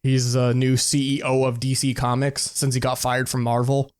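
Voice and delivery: normal talking voice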